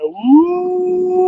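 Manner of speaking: short joyful whoop; high woo. Real, not acted. Angler cheering at the fish, high woo. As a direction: let out a happy woo.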